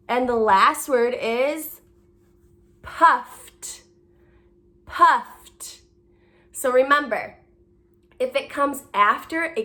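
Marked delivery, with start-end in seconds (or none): 2.8-5.8 "puffed" with ED as a released t; audible t ending. Shown as a demonstration. Teacher modelling the last word, "puffed" ending in crisp t. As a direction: say "puffed" alone, f into a separate t. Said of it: "you're going to add the t sound".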